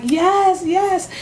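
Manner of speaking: high, eager double yes; re-enacted keen agreement; retold eager yes. Acts out eager answer to shopper.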